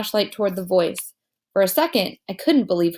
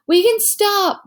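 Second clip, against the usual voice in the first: much higher, louder call; yelled; 11 semitones higher, 5 dB louder, slower than usual. A narrator's reading. Miles yells to the others from ahead, loud high call. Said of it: "Miles yelled from further up".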